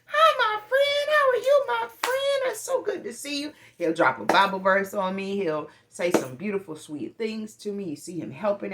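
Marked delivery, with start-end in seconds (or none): 0.1-3.5 very high, loud, sing-song friendly greeting; bubbly store clerk. Retold or imitated. Imitating the Food Lion guy greeting her, high and beaming. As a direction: gush at a regular like you've missed her. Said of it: "He's like".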